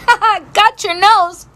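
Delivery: high, drawn-out mock "ha ha"; playful gotcha. Woman pranking a kid by grabbing her nose, playful gotcha. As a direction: crow it like a childish tease. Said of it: "I'm like".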